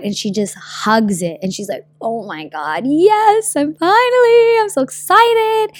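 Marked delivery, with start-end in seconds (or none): 2.0-5.7 high, thrilled gushing voice; excited squeal. Retold or imitated. Girl hugging her package, squealing with high excitement. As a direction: squeal it like a delighted customer.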